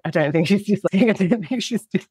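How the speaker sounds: half-laughing through the words; barely holding in a laugh. Real, not acted. Mum joking about daughter, laughing.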